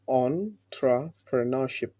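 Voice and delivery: entrepreneurship spoken slowly as "on-tra-puh-nuh-ship"; teacher repeats entrepreneurship clearly; slow model word. Shown as a demonstration. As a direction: drill entrepreneurship slowly, syllable by syllable. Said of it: "the correct way of pronouncing this word is".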